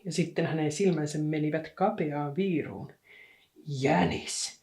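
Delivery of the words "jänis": low, hushed accusation; accusing. A narrator's reading. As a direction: hiss it with narrowed eyes. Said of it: "hän syytti".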